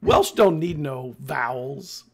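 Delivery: loud, slow mock declaration; hammed-up, drawn-out pronouncement; mock proclamation. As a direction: boom it out slowly as a joke. Joking declaration about Welsh spelling.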